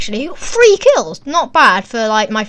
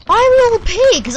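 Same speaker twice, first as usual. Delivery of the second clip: higher, louder incredulous repeat; baffled outcry; 9 semitones higher, 5 dB louder than usual. Real, not acted. Gamer asks the game again, louder and more baffled. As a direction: demand to know why, louder.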